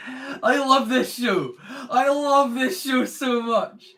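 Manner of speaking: slow, high, loud gushing; excited. Real, not acted. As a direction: gush over it with delight. Gushing about the show.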